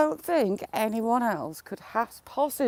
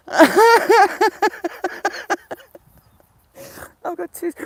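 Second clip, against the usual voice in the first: high, loud laugh breaking into tearful words; crying with laughter; 12 semitones higher, 8 dB louder than usual. Real, not acted. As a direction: laugh until the tears come.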